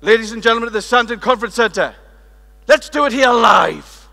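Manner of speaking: loud compere call, slowing down at the end; announcer voice. Acted. Speaker to the whole conference, loud hammed-up announcer. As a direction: work the room like a host.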